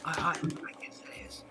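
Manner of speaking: hoarse breathy whisper, slow; croaky whisper. Acted. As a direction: barely croak it out. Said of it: "croaky voice".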